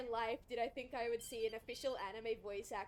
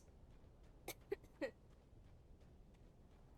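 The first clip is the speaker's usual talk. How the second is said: quiet, breath-heavy laugh; 4 semitones higher, 10 dB quieter than usual. Real, not acted. Player lost in the game, soft laugh. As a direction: laugh softly at yourself.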